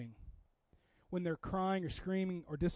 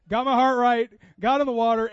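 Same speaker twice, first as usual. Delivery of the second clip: much louder, higher, victorious; triumphant burst; 4 semitones higher, 11 dB louder than usual. Real, not acted. Pastor to congregation, proud of getting in the pool, triumphant. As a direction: belt it out like a small victory won.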